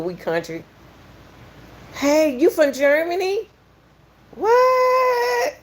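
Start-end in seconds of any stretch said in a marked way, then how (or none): 1.9-5.6 high, excited squealing; sudden high-pitched thrill. Real, not acted. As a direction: squeal with delight at the viewer. Excited reaction to a German viewer.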